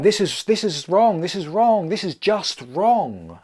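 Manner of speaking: high, slow, loud lament "this is wrong"; wailing animals. Acted. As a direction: moan it out like a dazed crowd. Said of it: "everyone's walking around going".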